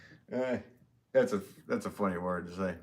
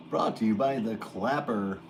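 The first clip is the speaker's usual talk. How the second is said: ad announcer voice. Acted. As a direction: sell it like a TV ad announcer. Mock sponsor line.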